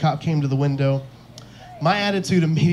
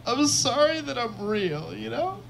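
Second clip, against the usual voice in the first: high, drawn-out tearful whine; mock crying; 9 semitones higher, 4 dB quieter, slower than usual. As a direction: whimper it slowly, much higher, as if weeping. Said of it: "just crying by myself".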